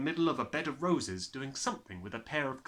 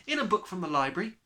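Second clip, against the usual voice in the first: Matilda; 7 semitones higher, 7 dB louder, faster than usual. Acted.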